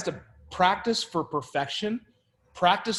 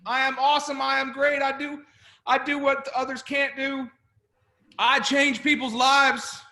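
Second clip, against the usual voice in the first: higher, proud and expansive; powerful affirmation; 6 semitones higher than usual. Shown as a demonstration. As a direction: declare it like you own the room. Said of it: "you wanna be big and powerful".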